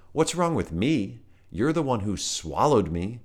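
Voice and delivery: high, loud indignant protest; indignant Volt. A narrator's reading. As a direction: throw the accusation back.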